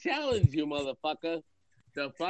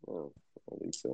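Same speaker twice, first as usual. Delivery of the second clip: whispered aside; 10 semitones lower, 11 dB quieter than usual. Real, not acted. Dry aside under breath.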